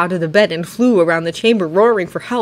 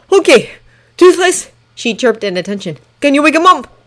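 urgent call; 7 semitones higher, 6 dB louder than usual. A narrator's reading. Mark urging Toothless in emergency.

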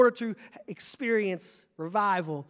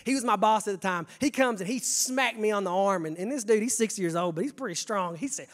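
ordinary delivery

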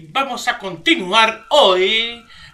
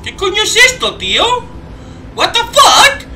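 shocked outburst; 12 semitones higher, 8 dB louder than usual. Real, not acted. Shocked reaction to the ship.

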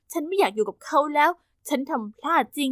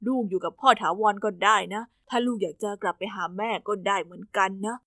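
soft father; 6 semitones lower, 5 dB quieter than usual. Acted. Father quietly offering his daughter the choice, low and soft. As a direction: lower and softer, a father coaxing gently.

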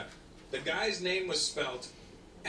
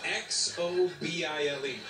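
slow spelling; 2 semitones lower, slower than usual. Retold or imitated. Spelling out the name letter by letter.

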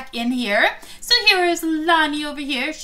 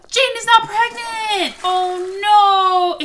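dismayed; 7 semitones higher, 7 dB louder, much slower than usual. Real, not acted. Announcing failed pregnancy test.

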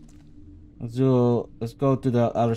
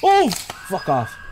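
startled yell; 8 semitones higher, 6 dB louder, much slower than usual. Real, not acted. Jump scare in game.

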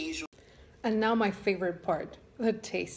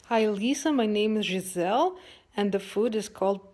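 louder, put-on Brazilian accent; 6 dB louder than usual. Giselle introduces her dish to Lisa, Brazilian accent. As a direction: play a Brazilian friend with a lively accent. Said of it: "tagged me to do the Brazilian accent".

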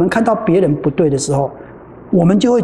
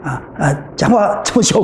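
ordinary talk, nothing marked

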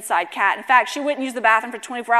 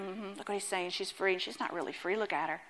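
muttered, much softer scoffing onlookers; mocking haters; 5 semitones lower, 15 dB quieter than usual. Preacher voicing scoffers muttering about the woman, hushed. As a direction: sneer quietly like a doubting crowd. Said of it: "the haters looking".

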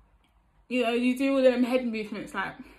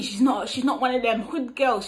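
normal talking voice

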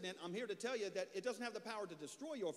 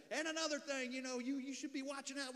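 bossy little cop; 5 semitones higher than usual. Retold or imitated. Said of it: "he's got a little bit of this Napoleon".

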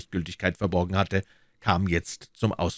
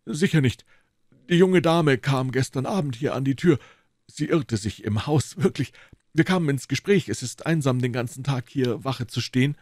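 higher, apologetic policeman making excuses; a guilty constable pleading innocence; mildly voiced, embarrassed guard; sheepish constable; 6 semitones higher than usual.

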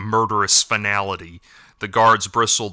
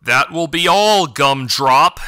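loud, higher, cutting dismissal; harsh; 5 semitones higher, 4 dB louder, slower than usual. A narrator's reading. Elder dismissing Bigham, harsh and commanding. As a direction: slap him down with his own silly name. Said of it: "declared the elder harshly".

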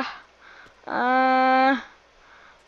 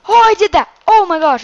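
higher, louder thrilled cry; excited; 9 semitones higher, 7 dB louder than usual. Real, not acted. Cheering her own sick jump in the game. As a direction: burst out proud of the jump.

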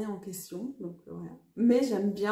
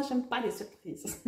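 laughing; 3 semitones higher, 5 dB louder, slower than usual. Retold or imitated. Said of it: "j'ai fait".

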